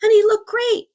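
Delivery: high, loud burst of wonder; delighted amazement. Real, not acted. Telling how the blotchy, bald friend now looked great, amazed. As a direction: exclaim it as a joyful surprise.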